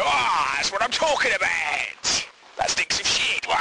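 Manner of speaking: a hyped-up Cockney lad revelling in the smell; Lee McQueen. Retold or imitated. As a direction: crow over the stink like a cocky Apprentice winner. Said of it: "And he'd go".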